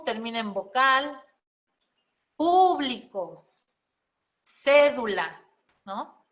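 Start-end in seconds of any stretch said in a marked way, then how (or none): 2.4-3.5 "público" slow, with a long PÚ; stressed first syllable. Shown as a demonstration. Teacher demonstrating the tonic syllable, "público" stretched on PÚ. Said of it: "se escucha la sílaba tónica". 4.4-5.4 "cédula" with a loud CÉ; stressed first syllable. Shown as a demonstration. Teacher demonstrating an esdrújula, "cédula" punched on CÉ. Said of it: "Esdrújula".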